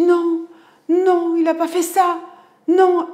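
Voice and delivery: high, loud, slow protest; yelling. Retold or imitated. Younger son yelling in anger at his brother, loud. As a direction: shout the denial at full force. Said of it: "j'entends mon fils cadet qui hurle".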